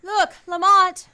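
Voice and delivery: loud, slow alarmed call; urgent cry. Acted. Margot alerting Lamont to the fleeing man, urgent cry. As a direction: shout the warning across.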